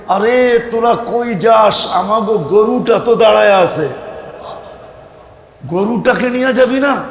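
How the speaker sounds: mock shout to halt a crowd running ahead; imitated shout. Pupil at the back calls classmates back for the cow, imitated shout. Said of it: "তো চিৎকার দিয়া বলছে".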